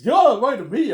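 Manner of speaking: loud, high mock demand; greedy yell. Peter demanding the money, a loud high mock yell. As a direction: bellow it like a greedy boss grabbing the cash.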